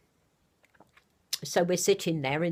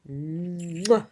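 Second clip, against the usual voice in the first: air kiss. Real, not acted. Grandmother blowing a kiss to Ginny. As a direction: blow a kiss down the phone. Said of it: "Big kisses".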